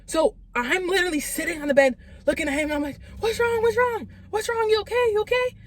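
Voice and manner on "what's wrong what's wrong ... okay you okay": high, urgent panicked questions; freaking out. Retold or imitated. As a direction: re-enact frantic worry in a high, rapid voice. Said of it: "Like, freaking out".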